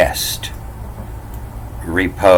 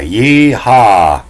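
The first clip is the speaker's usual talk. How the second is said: higher, louder yeehaw; cowboy holler; 5 semitones higher, 5 dB louder than usual. Acted. Command from Yella, a hollered yeehaw. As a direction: call it out like a horse rider. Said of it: "jump on your horse and you holler".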